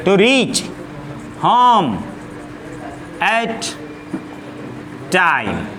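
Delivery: each word dictated alone with long pauses; slow dictation. Shown as a demonstration. Teacher dictating an English sentence to students, word by word. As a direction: spell the sentence out slowly for writing down.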